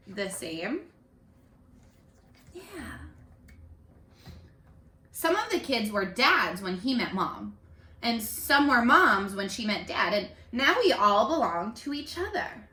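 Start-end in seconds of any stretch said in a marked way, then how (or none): 5.1-12.7 expressive picture-book reading; read-aloud voice for young kids; reading to children. A narrator's reading. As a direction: carry the class to the story's ending. Teacher reads picture book aloud.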